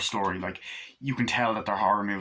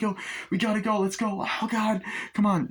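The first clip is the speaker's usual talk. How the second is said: urgent hurry; 11 semitones higher than usual. Retold or imitated. Urging escape from attack.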